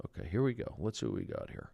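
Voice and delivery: soft unvoiced mutter; muttered whisper. Real, not acted. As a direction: say it under your breath.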